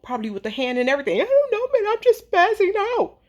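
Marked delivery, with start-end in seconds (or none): 1.2-3.2 high, sobbing imitation of a crying person; mock crying. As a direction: wail it like someone crying uncontrollably. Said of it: "a person is crying uncontrollably".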